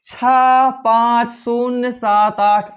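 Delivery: छः पाँच शून्य सात आठ read slowly, one separate digit at a time. Shown as a demonstration. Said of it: "इस प्रकार से हिंदी में पढ़ा जाएगा". (छः पाँच शून्य सात आठ को एक-एक अंक धीरे-धीरे अलग पढ़ें)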